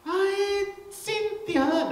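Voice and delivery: high sing-song call of a mother; mother calling child. Retold or imitated. Mother calling her daughter Cynthia from across the room, high sing-song.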